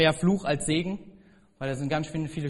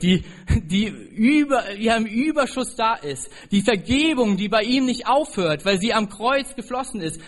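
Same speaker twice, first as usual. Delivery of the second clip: preaching climax; 4 semitones higher, 5 dB louder than usual. Acted. Sermon climax on God's love.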